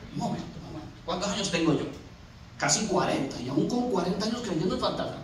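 low, soft inner reasoning; hushed, sceptical self-talk; musing to himself. Acted. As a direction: think it through under your breath.

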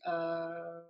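hesitant, rising "I guess"; unsure, awkward, trailing up; awkward unsure reply. Retold or imitated.

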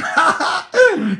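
high, loud cackling laugh; burst of laughter. Real, not acted. Priest bursts out laughing at a viewer's question. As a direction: crack up helplessly at the question. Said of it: "no puedo reírme".

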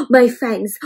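plain reading voice

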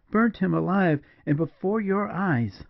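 high, aghast woman's voice; shocked, incredulous cry; horrified disbelief. A narrator's reading. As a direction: repeat it in high-pitched horror, drawing out the end. Julia repeats it in horror.

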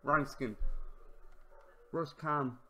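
usual speaking voice

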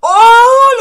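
long, high, loud "oh" of delight; excited scream. Real, not acted. Reader seeing her furious face, screaming with excitement. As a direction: scream out a drawn-out oh.

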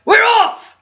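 loud pirate crew cheer; crew shout. Acted. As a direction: shout it all together. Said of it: "they all shouted".

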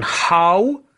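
How said with a clear open h and ow glide; model pronunciation. Shown as a demonstration. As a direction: say How like hau, not hoo.

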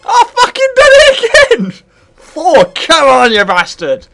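high, loud yell of triumph; exultant shout. Real, not acted. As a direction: roar it out, starting very high. Celebrates doing it again mid-game.

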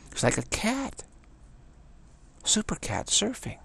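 high, delighted exclaiming; thrilled wonder, voice jumping up; excited marvel. Real, not acted. Marvelling at surfer's move.

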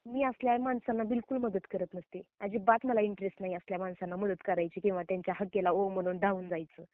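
usual speaking voice

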